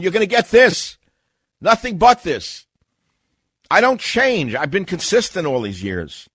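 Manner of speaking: high, loud insistence; emphatic rant. Real, not acted. Host tells listeners he won't change, loud defiant rant. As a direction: punch it out loud, pitched well up.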